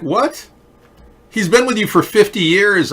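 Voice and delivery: indignant. Real, not acted. Indignant outburst at relative.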